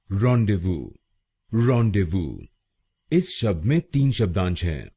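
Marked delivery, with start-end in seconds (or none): 0.1-2.5 "rendezvous" as ron-day-voo, z and s silent; rendezvous pronounced. Shown as a demonstration. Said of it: "इसकी सही pronunciation है". ("rendezvous" को रॉन-डे-वू बोलिए)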